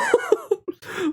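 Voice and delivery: soft squealing giggle; breathy high laugh; high-pitched laugh. Real, not acted. Laughing fit.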